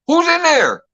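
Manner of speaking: high, alarmed call of a mother; imitating mom calling out. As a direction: voice the startled mom shouting through the door.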